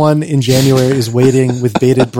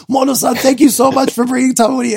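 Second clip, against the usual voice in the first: gushing fan; 9 semitones higher than usual. Retold or imitated. Townspeople thanking him.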